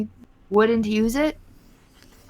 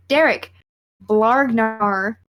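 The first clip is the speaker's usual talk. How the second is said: ordinary talk, nothing marked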